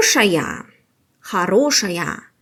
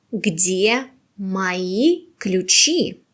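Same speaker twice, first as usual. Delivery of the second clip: slow model answer; much slower than usual. Shown as a demonstration. Teacher models answer slowly first.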